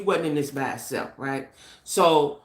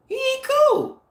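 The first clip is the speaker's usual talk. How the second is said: much higher, louder sneering repeat; exaggerated mocking dismissal; 3 dB louder, slower than usual. Vlogger mocking Vlad to her viewers, squealing high scorn. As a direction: push the mockery over the top.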